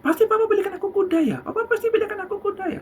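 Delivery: put-on high, fast child pestering dad; high quick childish pleading; insistent child. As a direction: rattle it off like an eager kid. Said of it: "kata si anak".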